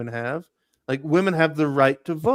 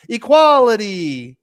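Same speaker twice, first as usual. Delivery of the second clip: louder, drawn-out sarcastic cheer; mock triumph; 6 semitones higher, 9 dB louder, slower than usual. Mocking men's church dress double standard with a sarcastic cheer. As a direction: proclaim it like a hollow victory.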